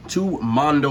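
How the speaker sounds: normal talking voice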